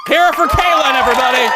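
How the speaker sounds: high, loud call to the crowd; shouted host introduction; rallying applause. Real, not acted. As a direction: whip up a big cheer for a guest. Host rallies applause for audience member.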